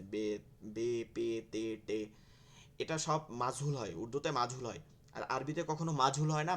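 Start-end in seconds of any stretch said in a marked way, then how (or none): 0.0-2.1 very slow বে with an Urdu e vowel; slow Urdu-style বে. Shown as a demonstration. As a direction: drag out বে the Urdu maktab way. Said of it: "উর্দুতে আমরা এভাবে পড়ছি".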